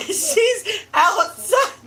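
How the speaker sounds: high, slow words squeezed through laughter; helpless laughter. Real, not acted. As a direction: struggle to speak while cracking up. Cracking up while telling own story.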